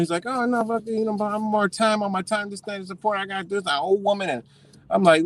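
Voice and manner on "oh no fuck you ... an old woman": high, softer imitated babble; high-pitched mimicry of the other man; mock rambling excuses. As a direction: ramble excuses like a flustered caller.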